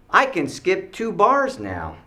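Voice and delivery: put-on high, slow, loud kid brag; boasting kid. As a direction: imitate a child proudly showing off. Kid boasting on monkey bars.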